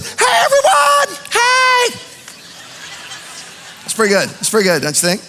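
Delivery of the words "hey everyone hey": very high, loud, stretched cheery hello; Bob Goff greeting. Retold or imitated. Pastor imitating a happy old man greeting the crowd, shouting high and cheery. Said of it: "Why is he up there like".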